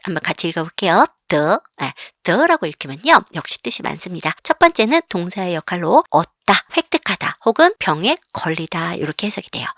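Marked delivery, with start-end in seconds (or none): none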